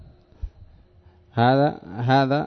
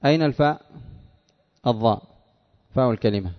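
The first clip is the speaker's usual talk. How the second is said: ordinary delivery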